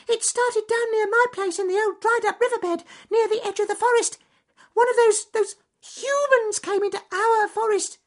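very high, loud, panicky squeak; frightened mouse. A narrator's reading. Francis Field Mouse telling the rabbits about the fire, high frightened squeak. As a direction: rush it out, terrified.

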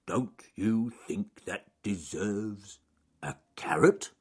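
slow, halting hopeful request; Charles Growly Bear. Acted. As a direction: ask it ponderously, pausing before the last words. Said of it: "mumbled Charles Growly Bear".

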